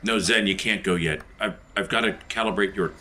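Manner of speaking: normal talking voice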